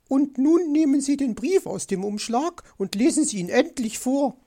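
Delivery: high-voiced demanding magpie; insistent, high-pitched demand. A narrator's reading. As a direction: press him impatiently, pitched high. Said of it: "forderte sie".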